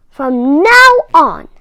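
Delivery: a touch of a stern coach, loud and slowed; hint of a gruff coach voice. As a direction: lay down the rule like a strict flying coach. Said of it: "said Comet".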